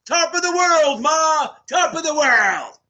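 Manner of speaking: high, loud, hammed-up movie yell; triumphant shout. Streamer celebrating a student's pass with a quote, triumphant shout. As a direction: holler it from the rooftop, drawing out the end.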